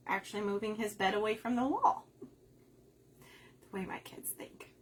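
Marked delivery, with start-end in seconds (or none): 2.2-4.7 whispered aside. Real, not acted. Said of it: "aside about her kids".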